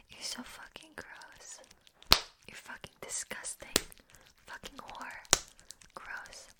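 hushed, breath-only insults; whispered contempt. Acted. Belittling the listener at close range, whispered contempt.

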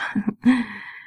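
brief breathy giggle; playful laugh. Acted. Having fun with the lover, playful laugh. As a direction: laugh lightly, as if sharing fun.

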